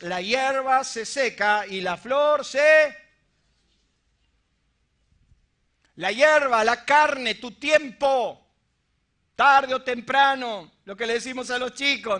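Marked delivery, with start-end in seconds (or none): 0.0-10.7 loud, slow, drawn-out sermon shout; fiery preaching. Acted. Pastor crying out to the congregation about fading life. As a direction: hammer each word home to the church.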